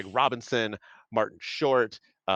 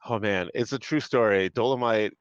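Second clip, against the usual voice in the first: usual speaking voice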